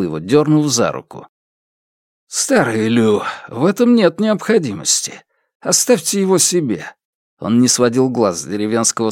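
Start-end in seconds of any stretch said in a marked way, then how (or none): 2.3-6.8 higher-pitched conciliatory voice; smooth things over with the elder; placating. A narrator's reading. Visitor placates the village elder.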